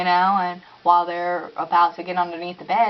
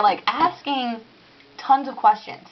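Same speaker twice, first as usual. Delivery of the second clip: ordinary talk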